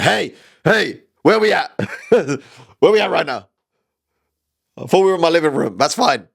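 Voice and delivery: woozy and lost, wondering where he is; drugged-out guy. Retold or imitated. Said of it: "he'd be like".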